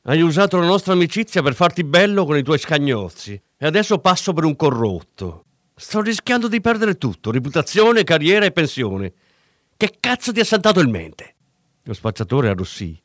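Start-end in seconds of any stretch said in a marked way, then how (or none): 0.0-11.3 raised, higher furious tirade; angry reproach. A narrator's reading. Cop rages at the dealer who used his name.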